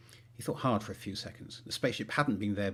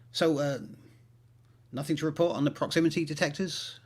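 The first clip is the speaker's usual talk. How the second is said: higher, probing questions over the radio; a higher, edgy astronaut checking in; nervous astronaut radio check; 7 semitones higher, 4 dB louder than usual. Acted. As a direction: fish for news without giving it away.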